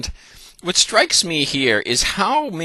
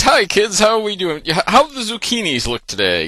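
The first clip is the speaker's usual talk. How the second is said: put-on cheery, higher-pitched greeting to the kids; higher-pitched, chipper gardener God; happy zucchini man; 8 semitones higher, 4 dB louder than usual. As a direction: play a jolly God dropping by the garden. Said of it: "He's the happy zucchini man".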